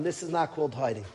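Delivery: "This is not called hiding" said quietly, spent; softly repeated. Retold or imitated.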